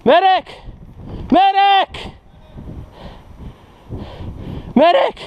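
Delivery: man down shout. Real, not acted. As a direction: shout for help across the field. Calling for medic.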